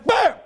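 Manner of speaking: sudden high shouted "bam"; explosion noise. Retold or imitated. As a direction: burst out like a grenade blast. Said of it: "Blows up right in my face".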